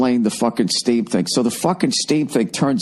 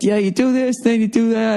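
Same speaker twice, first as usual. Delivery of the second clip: high mock voice of the spa guy explaining; lilting high imitation of the attendant; 10 semitones higher than usual. Imitating the spa guy explaining the steam room in a high mock voice.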